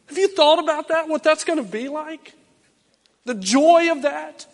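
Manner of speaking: loud, high, fervent preaching; preaching at full force. Acted. Preacher stirring the church, loud and fervent. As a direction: drive it home to the congregation.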